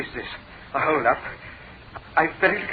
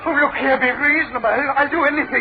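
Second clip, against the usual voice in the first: higher, pleading, stammering; desperate begging; 8 semitones higher than usual. Acted. Preston begs Blake for mercy, pleading. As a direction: grovel for mercy.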